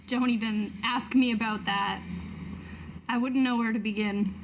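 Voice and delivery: ordinary talk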